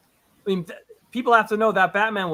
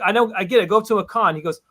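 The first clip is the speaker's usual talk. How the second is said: ordinary delivery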